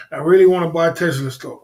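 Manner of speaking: laughing. Real, not acted. Repeating his wish to buy Tesla stock, laughing.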